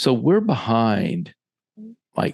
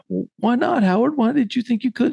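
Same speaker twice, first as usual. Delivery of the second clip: high-pitched, incredulous questions; retold bafflement with the voice lifted high; baffled questioning; 14 semitones higher than usual. Questioning Howard on why he couldn't tackle, high and baffled.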